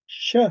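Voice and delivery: "Sh" aislado, una fricativa palatal; sonido sh. Shown as a demonstration. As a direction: pronuncia solo el sonido "Sh". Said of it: "atención". ("Sh" suelto, como muestra del fonema)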